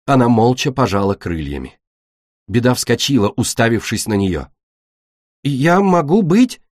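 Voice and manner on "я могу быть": high, slow wondering question; hopeful disbelief. Acted. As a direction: ask it slowly with rising hope. Беда hopes she is the fifth. (с робкой надеждой, высоко и медленно)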